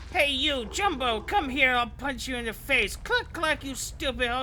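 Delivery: high, loud goblin heckling; goblin taunt. Pretend. Player taunting a big enemy, high goblin voice.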